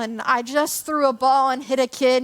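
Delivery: usual speaking voice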